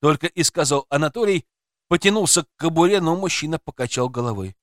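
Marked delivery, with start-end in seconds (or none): none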